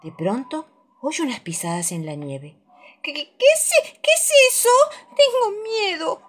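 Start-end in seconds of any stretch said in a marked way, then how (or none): 3.0-6.2 high, stuttering frightened cub; trembling, tearful little voice; scared little bear. Acted. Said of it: "dice en un susurro".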